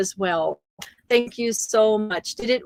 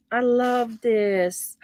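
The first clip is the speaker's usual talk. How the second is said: ordinary delivery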